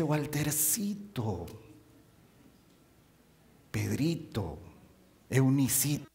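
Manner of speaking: soft, slow pet names; tender diminutives. Acted. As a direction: call each name fondly. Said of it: "que nos dice". (Cristo llamando con cariño a sus hijos)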